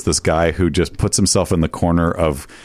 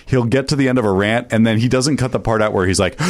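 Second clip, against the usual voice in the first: ordinary delivery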